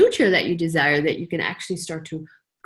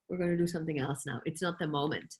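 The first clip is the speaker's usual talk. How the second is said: much softer, murmured to herself; quiet aside; 8 dB quieter than usual. Retold or imitated. Telling herself the scene can wait, quietly and softly.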